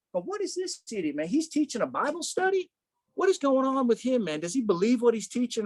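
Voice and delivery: acted-out baffled outrage; incredulous onlooker. Voicing onlookers appalled at his tantrum.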